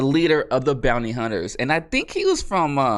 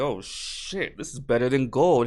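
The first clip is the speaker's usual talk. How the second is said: awed reaction. Retold or imitated. Mandalorian marvels at the armor payment, mock awe. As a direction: play a hushed, impressed reaction.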